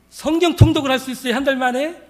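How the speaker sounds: high, loud astonished question; astonished, voice jumping up; amazed question. Acted. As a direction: ask it in acted disbelief. Woman amazed she could finish Bible.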